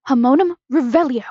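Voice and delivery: loud, commanding incantation; spell-casting shout. A narrator's reading. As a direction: cast the spell with full voice. Spell cast in the story.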